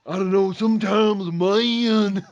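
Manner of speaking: high, exaggerated Southern drawl; Rick from The Walking Dead. Mocking a British actor's ridiculous fake Southern accent. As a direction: stretch it into mock Southern peaks and valleys. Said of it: "it's ridiculous when he's like".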